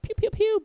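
high-pitched "pew" noises; mock gunshots. Gamer imagining shooting through the top, mock gunshots. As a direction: pop out toy-gun sounds, pitched high. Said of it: "been like".

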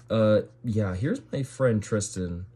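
ordinary talk

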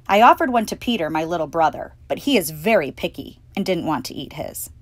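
ordinary delivery

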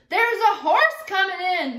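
loud roaring lookout call; roared. A narrator's reading. Town lookout roaring to everyone in town, loud. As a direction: bellow it out from the lookout tower. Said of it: "he roared".

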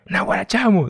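high-pitched mock fan greeting; imitating an excited fan. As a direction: squeal it up high like a fan spotting him. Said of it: "dicen, ya se les pegó".

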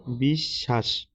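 বিশ্বাস said "bish-shash", the b of the cluster silent; correct pronunciation demo. Shown as a demonstration. Teacher answering the exam question, careful "bish-shash" for বিশ্বাস. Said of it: "শুদ্ধ উচ্চারণ কোনটি".

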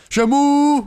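Shamu with a loud, high, stretched "ooh"; exaggerated pronunciation. Shown as a demonstration. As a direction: call out Shamu, holding a soaring last vowel. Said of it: "I just love pronouncing it that way".

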